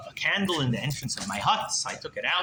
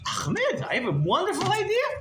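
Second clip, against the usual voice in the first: high-pitched enthusiastic pitch; excited brainwave. Retold or imitated. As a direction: sell the sudden idea eagerly. Captive pitches his trick.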